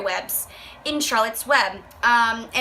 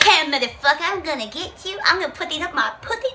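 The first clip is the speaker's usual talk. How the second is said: mock baby; 4 semitones higher than usual. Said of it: "It's like".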